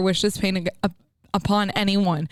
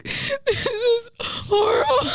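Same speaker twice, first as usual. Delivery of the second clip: high, drawn-out hammed-up wail; retold sobbing, pitched way up; mock crying; 11 semitones higher, much slower than usual. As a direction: wail it like you've burst into tears. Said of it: "I just started crying".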